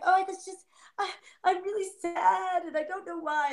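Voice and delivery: high-pitched, hammed-up sadness; mock sad feelings. Voicing the feelings for a client, mock sad and high. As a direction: play a pouting, upset feeling. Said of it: "the feelings are like".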